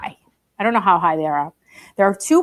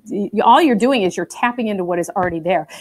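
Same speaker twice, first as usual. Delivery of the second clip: ordinary delivery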